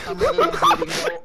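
high, loud, desperate; panicked shout. Real, not acted. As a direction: yell it while bleeding out. Wounded, panicking.

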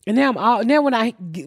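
ordinary delivery

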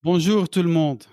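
an easy, natural French greeting to everyone; native French greeting. Real, not acted. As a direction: greet the room in real French, relaxed. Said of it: "you do it".

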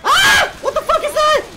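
very high, loud shriek; terrified scream. Real, not acted. Player sees strange monster, terrified shriek.

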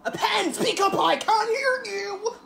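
high, slow demanding teacher; pretending not to hear. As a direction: bellow I can't hear you, mocking. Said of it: "That's my favorite one".

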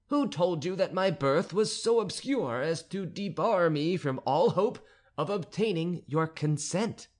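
abbé protesting, growing bold; pleading abbé. Acted. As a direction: defend your birth to the countess. Abbé protests his birth.

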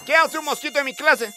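usual speaking voice

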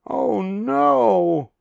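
high, drawn-out pig wail; Pig's high cry of dismay; despairing cry. Acted. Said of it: "Pig cried in despair".

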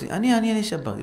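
high, eager me-me; a touch of a child's eagerness. Acted. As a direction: jump in to take the blame. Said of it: "אחד שאל".

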